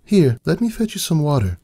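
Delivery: fretting over his late return; worried wife. A narrator's reading. Wife voiced by narrator.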